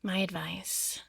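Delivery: breathy sultry whisper; husky seductive whisper. Acted. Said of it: "like, your sultry voice".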